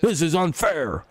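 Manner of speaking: high, slow whiny voice; mock a crybaby whining; hammed-up high whine; mock whiny complaint. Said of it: "you're like".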